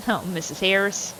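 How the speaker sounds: normal talking voice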